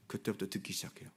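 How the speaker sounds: hushed whisper, no voice. Real, not acted. Preacher hushes the congregation, whispering. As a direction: lean in and breathe it. Sermon point dropped to hush.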